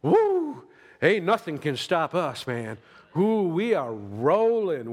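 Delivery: big high whoop, loud and slow; excited whoop. Retold or imitated. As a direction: whoop it up like nothing can stop you. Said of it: "you're like".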